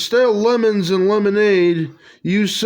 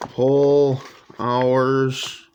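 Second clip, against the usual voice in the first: usual speaking voice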